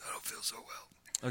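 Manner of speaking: slow, whispered aside, feigning illness; mock-sick whisper. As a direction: whisper slowly as if pretending to feel ill. Joking aside after licking fingers.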